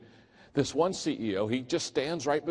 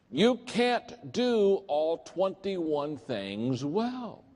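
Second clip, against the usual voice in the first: slow emphatic point; 7 semitones higher, much slower than usual. Real, not acted. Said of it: "emphasis on key point".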